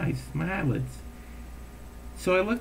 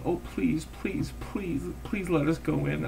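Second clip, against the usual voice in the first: begging; 4 semitones higher, 6 dB quieter than usual. Retold or imitated. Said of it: "he's, like, begging me".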